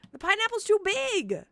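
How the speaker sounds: high-pitched, hammed-up whine at full voice; mock wailing protest. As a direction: wail it in playful despair. Wailed protest, then repeated softer.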